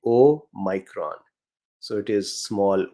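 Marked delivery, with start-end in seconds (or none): 0.1-1.2 Omicron as "oh-MY-kron"; long-i pronunciation. Shown as a demonstration. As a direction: say Omicron with "my" in the middle. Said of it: "But it is actually".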